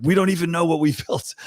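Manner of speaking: imitating clueless founders, high and quick; mock bewilderment. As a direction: rattle it off as baffled founders, amused. Acting out baffled AI founders.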